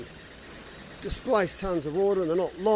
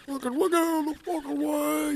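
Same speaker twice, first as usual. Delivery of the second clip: mock ship crew yell; 10 semitones higher than usual. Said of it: "Yelling down from the deck".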